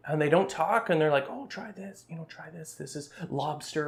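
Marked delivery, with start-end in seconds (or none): 1.3-3.9 hushed imitation of an ASMR video; soft ASMR voice. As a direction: murmur it softly to the mic. Imitating ASMR eaters' soft talk.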